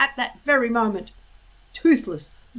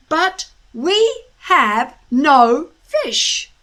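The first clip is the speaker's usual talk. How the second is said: loud, slow, firm but friendly; forced pleasant; 5 semitones higher, 10 dB louder, much slower than usual. A narrator's reading. Hiccup tries to dominate the dragon, firmly pleasant. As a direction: sound pleasant and in charge. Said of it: "make his voice sound pleasant".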